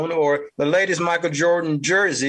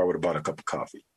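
muttered aside; 13 semitones lower, 8 dB quieter, much faster than usual. Real, not acted. Low muttered punchline aside.

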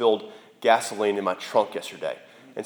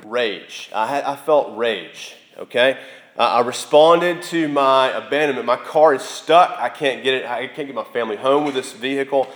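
normal talking voice

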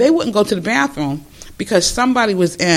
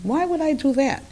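higher, doubtful question; lightly voiced doubtful young woman; skeptical granddaughter; 9 semitones higher than usual. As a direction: push back on grandma.

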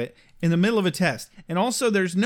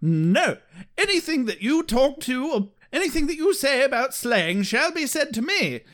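high, stiff, pompous Englishman; pompous Wesley; 7 semitones higher than usual. Retold or imitated. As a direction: lay down the law, high and haughty. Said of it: "He's like".

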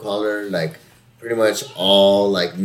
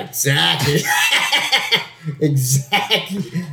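laughing; 6 semitones higher, slower than usual. Real, not acted. Cracking up at the joke.

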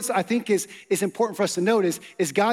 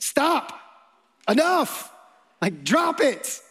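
a higher, sharp parent's "Stop. Enough."; firm parent; 6 semitones higher, slower than usual. Pretend. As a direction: cut the fight off like a parent stepping in. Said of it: "a parent application".

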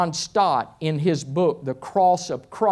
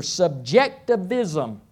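slow, syllable-by-syllable big word; slower than usual. Shown as a demonstration. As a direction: draw out SUBJECTIVISM one syllable at a time. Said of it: "I have to say it slow".

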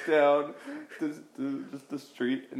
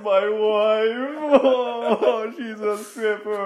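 mock bawling; 8 semitones higher, 3 dB louder, much slower than usual. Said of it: "this guy just comes in bawling".

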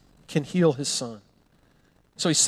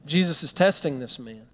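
ordinary talk

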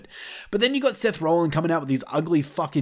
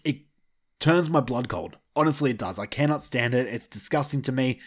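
ordinary talk, nothing marked

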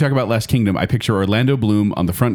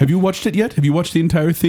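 higher mock Jacob voice; a raised teasing impression of Jacob; imitating Jacob; 6 semitones higher than usual. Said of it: "Whose voice is that?".

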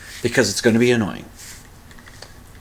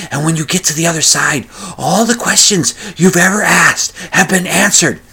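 loud, raised mock stage whisper; exaggerated fake whisper, louder and higher; talking like whispering; 5 semitones higher, 3 dB louder than usual. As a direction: strain as if whispering, but at full volume. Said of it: "talking like they're whispering".

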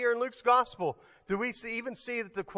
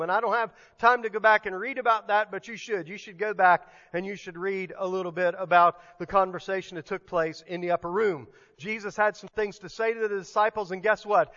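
ordinary talk

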